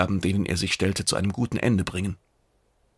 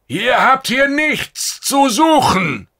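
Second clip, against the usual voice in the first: high, loud, slow scream; shrieking defiance at the intruders; furious shout; 7 dB louder, slower than usual. Acted. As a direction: scream them out of his refuge. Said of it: "schrie Lekore".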